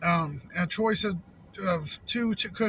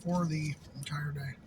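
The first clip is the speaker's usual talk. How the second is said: soft whispered aside; 4 semitones lower, 6 dB quieter than usual. Real, not acted. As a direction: whisper it under your breath. Aside on calorie count.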